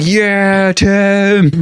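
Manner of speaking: high, mocking character voice. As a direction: squeak out a mock sarcastic yeah Tim. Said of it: "but also it's like".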